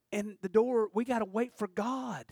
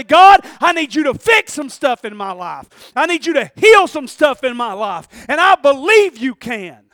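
preached prayer, loud and high; loud pleading prayer; 4 semitones higher, 15 dB louder than usual. Acted. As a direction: cry out to God, pleading at full volume. Prayer voiced from the pulpit.

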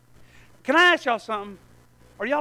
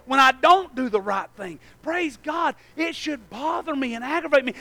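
normal talking voice

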